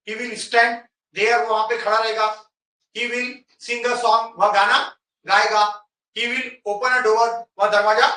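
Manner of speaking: slow teaching demo. Shown as a demonstration. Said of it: "You have to practice in a very easy way".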